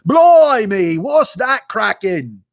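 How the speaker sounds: loud, high, hammed-up British exclamation; mock British accent. Coworker walking in on the night shift, mock British accent. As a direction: shout it in an exaggerated British accent. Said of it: "whatever the fuck you guys say".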